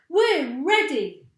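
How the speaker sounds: loud, high, slow eager reply; eager chorus. A narrator's reading. Monkeys answering Mugglewump, loud eager reply. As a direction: answer like keen little monkeys. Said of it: "said the monkeys".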